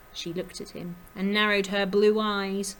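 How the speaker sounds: ordinary delivery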